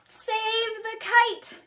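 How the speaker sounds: high, stretched-out rallying cry; urgent shout. A narrator's reading. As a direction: call out slowly and high to the rescue. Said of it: "shouted Mole".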